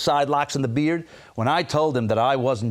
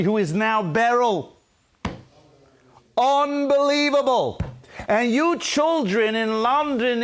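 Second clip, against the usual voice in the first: fervent preaching; 8 semitones higher than usual. Acted. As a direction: proclaim the revelation to the whole congregation. Preaches the story's revelation.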